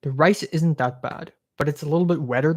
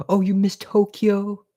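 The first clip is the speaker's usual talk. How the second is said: mock host question; 5 semitones higher than usual. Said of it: "imitating host again".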